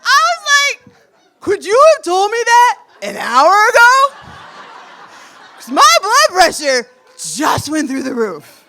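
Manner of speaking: high, loud words through laughter; laughing. Real, not acted. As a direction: laugh your way through the disbelief. Laughing at punchline reveal.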